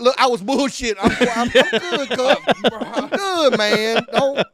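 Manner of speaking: mock panic, talking your way out of a fight; backing down. Imitates backing down after a duck.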